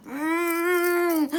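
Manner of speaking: a high, closed-mouth scream held in; muffled underwater scream. Shown as a demonstration. Showing her scream under the water, muffled closed-mouth scream. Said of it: "I would just scream at the top of my lungs".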